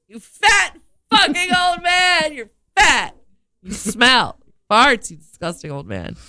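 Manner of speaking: women abusing the farting man, mock yelling; mock shouted abuse. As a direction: rip into the old man. Acting out verbal abuse.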